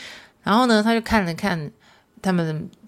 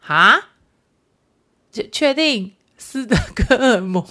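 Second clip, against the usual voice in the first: laughing disbelief; 5 semitones higher, 4 dB louder, slower than usual. Real, not acted. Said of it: "我就在电话这一头爆笑".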